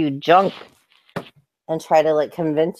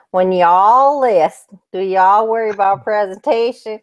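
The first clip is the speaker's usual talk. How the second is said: Texas accent; 3 semitones higher, 3 dB louder than usual. Shown as a demonstration. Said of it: "This is my Texas accent".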